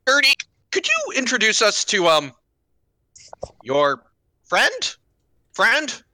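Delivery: high, tentative question with rising ends; Runt asking. Acted. Runt asking Gertie for an introduction, in a high questioning voice.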